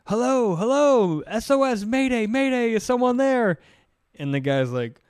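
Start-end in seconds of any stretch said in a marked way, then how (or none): 0.1-3.6 高く大きめの声で慌てた遭難無線; 必死に助けを呼ぶ芝居がかった声; mock distress call. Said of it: "Mayday call".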